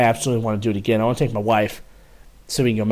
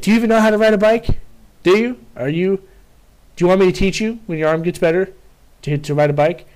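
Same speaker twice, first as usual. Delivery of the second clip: higher, fast sarcastic taunt; mocking first sergeant; 6 semitones higher, 3 dB louder than usual. First sergeant mocking the corporal's biking, high sarcastic. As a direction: taunt him like a child who can't ride.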